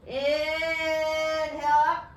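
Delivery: high, loud, stretched-out yoga cue; drawn-out breath cue. Real, not acted. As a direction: stretch the words over a long breath in. Cueing the breath in yoga movement.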